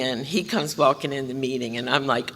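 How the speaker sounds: ordinary talk, nothing marked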